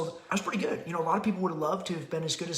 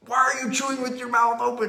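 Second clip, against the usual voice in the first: put-on higher, sharper exasperated question to the kid; exasperated scold; 7 semitones higher, 6 dB louder than usual. Dad scolding daughter at the table, exasperated higher raised voice. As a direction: scold the open-mouthed chewing.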